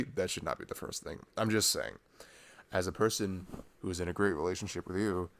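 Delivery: ordinary talk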